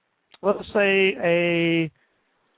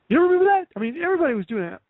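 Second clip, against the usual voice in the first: mock amazement; 12 semitones higher, much faster than usual. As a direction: jump up in pitch, then rattle the rest off fast. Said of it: "He's like".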